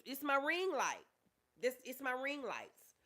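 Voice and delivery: ordinary talk, nothing marked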